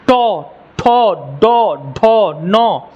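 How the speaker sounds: slow, separate तो, ठो, डो, ढो, नो; held retroflex syllables ठो, डो, ढो, नो; retroflex sound drill. Shown as a demonstration. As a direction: sound out ठो, डो, ढो one at a time, drawn out. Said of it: "उसको हम मृदा कहते हैं".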